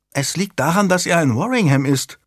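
put-on high child's pronouncement; small girl piping in; announcing little girl. A narrator's reading. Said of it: "verkündete Anne unerwartet".

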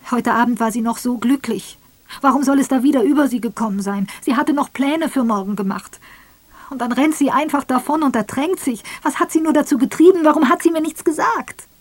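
high, loud and fast, rising anguish; husband raging at his wife's death; despairing outburst. Acted. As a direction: pour out the questions in grief. Grieving husband's outburst continues.

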